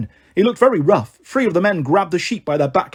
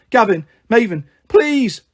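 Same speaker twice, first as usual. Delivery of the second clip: pleading shout, rising high at the end; raised-voice appeal; shouted plea; 4 semitones higher, 4 dB louder than usual. A narrator's reading. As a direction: beg them to step in. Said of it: "shouted Malcolm".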